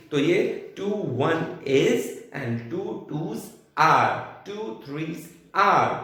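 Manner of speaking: teacher spelling out "टू threes are"; emphasised demo. Shown as a demonstration. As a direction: stress the word "are" for the students. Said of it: "ये होता है टू one is टू".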